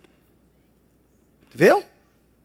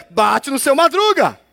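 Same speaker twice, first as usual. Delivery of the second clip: ordinary talk, nothing marked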